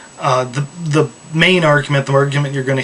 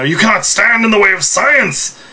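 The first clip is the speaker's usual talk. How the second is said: megalomaniac rant, raised and loud; supervillain voice; 6 semitones higher, 3 dB louder than usual. Pretend. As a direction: proclaim it like a mad supervillain. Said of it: "I'm not trying to sound like a supervillain here".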